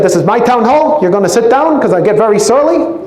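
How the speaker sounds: high, hectoring Bernie voice; Bernie Sanders impression. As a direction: scold them like cranky Bernie. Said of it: "I would do a Bernie Sanders impersonation".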